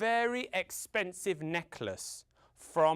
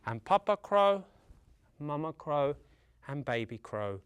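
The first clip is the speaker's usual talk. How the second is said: ordinary delivery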